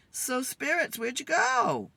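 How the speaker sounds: high, loud call out to the spirits. Real, not acted. Calling out to the spirits again.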